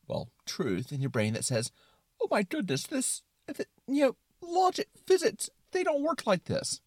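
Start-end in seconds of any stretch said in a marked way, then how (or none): 2.2-6.8 high-pitched worried doubter in the head; fretting inner voice. Acted. The brain protesting at movie physics, high and flustered.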